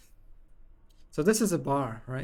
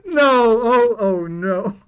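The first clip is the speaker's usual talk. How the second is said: laughing dismay; 5 semitones higher, 9 dB louder, slower than usual. Real, not acted. Reacts to her own voice crack.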